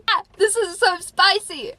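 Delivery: very high, loud, drawn-out delight; slow, gleeful high-pitched cry; excited squeal. Real, not acted. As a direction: squeal over juicy gossip. Excited reaction to juicy diary page.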